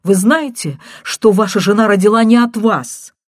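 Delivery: frantic woman dropping the news; hurried, agitated woman. A narrator's reading. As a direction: blurt out the bombshell in a rush. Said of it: "прерывистый, торопящийся голос женщины".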